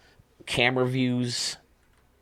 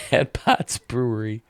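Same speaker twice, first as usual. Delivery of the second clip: normal talking voice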